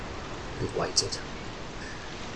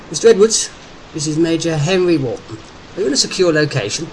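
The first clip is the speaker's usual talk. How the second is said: loud, higher-pitched military officer on a call; commanding army major; 5 semitones higher, 4 dB louder than usual. A narrator's reading. Major Wharton questioning Derek by phone, loud and commanding. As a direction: take charge like an officer.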